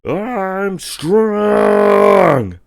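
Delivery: high, loud shouted boast; Raiden shout. Retold or imitated. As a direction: yell it like an anime hero. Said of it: "he goes".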